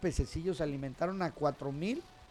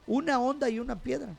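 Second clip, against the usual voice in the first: predicación enérgica; 5 semitones higher, 4 dB louder than usual. Real, not acted. Predicación a todo volumen.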